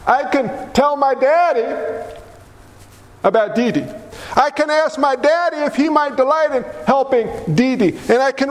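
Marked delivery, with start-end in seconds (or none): none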